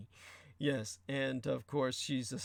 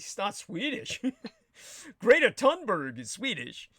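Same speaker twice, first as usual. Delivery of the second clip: laughing; 8 semitones higher, 6 dB louder than usual. Real, not acted. Laughing retort to cosmetologist.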